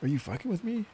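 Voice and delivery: put-on a kid unsure if it's a joke; mock shocked; incredulous. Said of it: "I was like".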